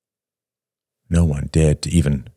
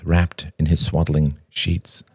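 ordinary delivery

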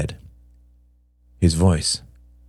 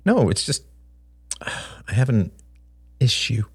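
sighing, hesitant; 6 semitones higher than usual. A narrator's reading.

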